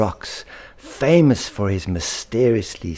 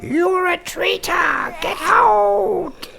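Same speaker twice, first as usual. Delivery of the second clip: very high, shrieking traitor accusation; delirious scream; 4 dB louder, slower than usual. Acted. Hazen driving his brother Thorpe from the flock in fury. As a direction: screech it like a maddened bird. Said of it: "screamed Hazen deliriously".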